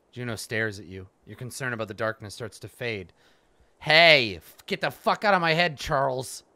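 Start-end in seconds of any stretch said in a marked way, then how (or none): 3.8-6.4 loud furious outburst; angry shout. Real, not acted. Player yelling at the mind-controlling wizard, furious. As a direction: yell at the intruder in your head.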